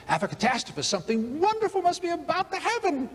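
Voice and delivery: put-on sudden high sing-song cheer from the stuck young lady; hopeful lady in a jam. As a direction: lift way up and chirp it like a brave optimist in the mud. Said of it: "She says".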